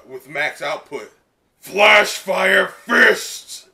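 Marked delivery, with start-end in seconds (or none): 1.6-3.7 slow, loud, high battle cry; drawn-out hero shout; shouted move name. Acted. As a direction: bellow the attack name like an anime hero.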